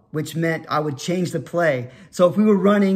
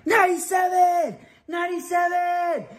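mock-yell the numbers at the line; yelled signals; 12 semitones higher than usual. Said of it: "I would just yell out numbers".

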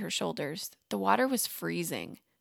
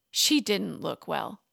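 plain reading voice

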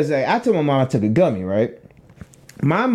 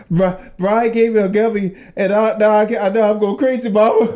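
higher, whining confession to Mom; mock helpless panic; 6 semitones higher than usual. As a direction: plead like a scared son, half laughing.

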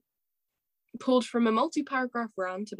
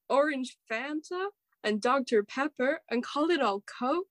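a light American accent on the soda list. Shown as a demonstration. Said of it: "I can only do an American accent".

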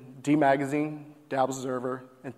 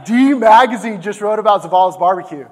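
higher and much louder, bursting with joy; excited shout; 6 semitones higher, 12 dB louder than usual. Retold or imitated. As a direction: shout it like the best news ever. Reliving the thrill of press coverage.

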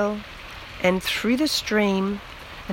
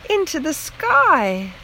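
usual speaking voice

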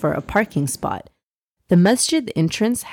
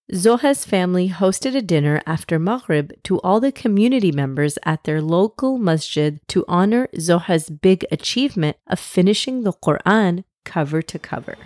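usual speaking voice

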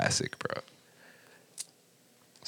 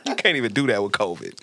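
ordinary talk, nothing marked

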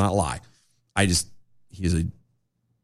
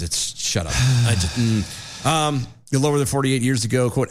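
ordinary talk, nothing marked

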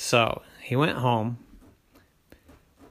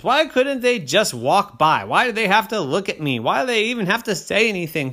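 higher, louder whiny complaint; whiny grumbling child; grumpy kid; 10 semitones higher, 9 dB louder than usual. A narrator's reading. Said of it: "said Oren".

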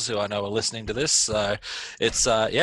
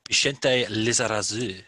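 French pronunciation; 3 dB louder, slower than usual. Pretend. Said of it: "What a French name".

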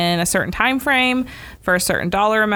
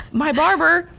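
usual speaking voice

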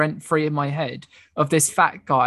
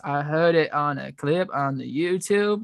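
slow, tipsy Southern drawl; drunk Southern friend. Retold or imitated. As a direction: imitate a drunk Southerner explaining themselves. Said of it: "She was so drunk".

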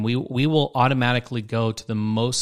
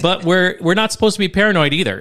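louder, firmer restart; stronger voice; 6 semitones higher, 5 dB louder than usual. Shown as a demonstration. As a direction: say it with more force. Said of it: "my voice is pretty weak".

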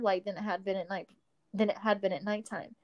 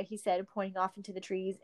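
ordinary delivery

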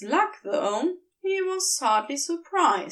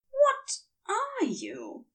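high, slow, wary angel voice; a much higher, careful Aziraphale; 13 semitones higher, slower than usual. A narrator's reading. The angel asks the demon warily, high and slow. Narrator voicing angel character.

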